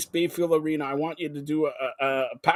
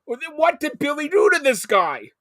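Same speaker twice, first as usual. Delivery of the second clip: loud, slow, high annoyed complaint; annoyed shout; 8 semitones higher, 7 dB louder, slower than usual. Real, not acted. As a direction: bellow it out, fed up with waiting. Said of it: "I'm a little bit annoyed".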